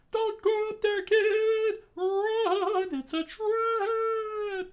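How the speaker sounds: very high, very loud drawn-out shriek; Sanji's scream. Acted. Friend warns Luffy of a trap, screaming. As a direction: scream a desperate warning, stretching the last words. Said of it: "Sanji screamed out".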